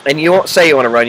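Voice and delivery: ordinary talk, nothing marked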